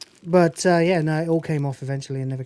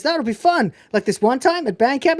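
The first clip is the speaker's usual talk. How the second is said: put-on much higher, fast babbling girl voice; chirpy band geek; 8 semitones higher, 3 dB louder than usual. High eager girl voice as Michelle rambles about band camp to Jim. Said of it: "he says".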